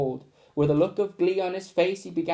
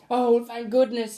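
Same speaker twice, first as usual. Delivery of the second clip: put-on high, relieved child's voice; relieved boy; 7 semitones higher, 4 dB louder than usual. Roland thanking the teacher, high and relieved. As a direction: sigh it out with relief. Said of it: "exclaimed Roland".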